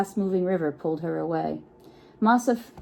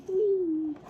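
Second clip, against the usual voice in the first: high, gleeful squeal; very high, playful cry of joy; playful whee; 11 semitones higher than usual. Pretend. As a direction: shriek with delight like a kid jumping in. Kids jumping in hot spring.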